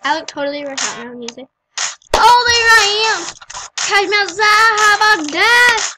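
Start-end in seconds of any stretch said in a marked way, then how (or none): 2.1-5.9 excited. Real, not acted. Excited at seeing herself.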